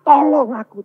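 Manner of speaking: loud, high cry for help. Acted. Believer crying to God for help, loud high shout. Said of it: "terus teriak".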